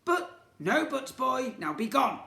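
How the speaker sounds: high, loud sneering shopkeeper; scornful villain twin. Acted. Twin shopkeeper blaming the boy, high loud sneer. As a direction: scorn the little vandal.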